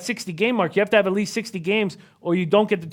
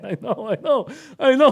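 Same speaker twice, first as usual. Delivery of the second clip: real laugh breaking into the words; 5 semitones higher than usual. Real, not acted. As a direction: chuckle through it, pitch climbing. Amused by listener's whisper remark.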